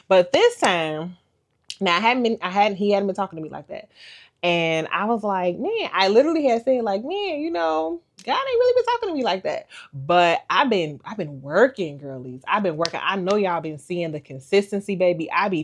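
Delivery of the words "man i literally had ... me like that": much higher, whining lament; mock whiny complaint. Vlogger imitating herself complaining God is silent, much higher. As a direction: complain to the sky, pitched way up.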